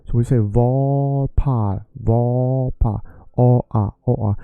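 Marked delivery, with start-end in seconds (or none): 0.4-3.0 var with a long O, par with an open A; O against A. Shown as a demonstration. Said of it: "the A is pronounced O". 3.4-4.4 bare O then A, each clearly separated; contrasting vowels. Shown as a demonstration. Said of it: "the A is pronounced O".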